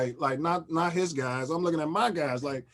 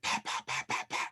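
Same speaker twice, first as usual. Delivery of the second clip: imitated gunshots; 8 dB quieter than usual. Gunshots on the live news.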